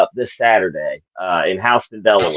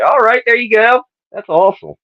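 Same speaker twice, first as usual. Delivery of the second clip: mock crowd cheer; 11 semitones higher than usual. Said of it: "It's like".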